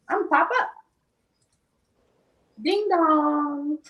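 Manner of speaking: high, sing-song doorbell; playful pop-in. Retold or imitated. Friend showing up at the door.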